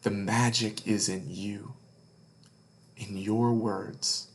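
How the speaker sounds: plain reading voice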